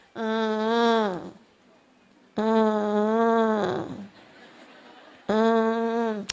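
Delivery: low droning fake whine; long, low, unconvincing whimper; mock child's whimper. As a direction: imitate a child's half-hearted crying. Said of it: "ada anak nangis gini nih".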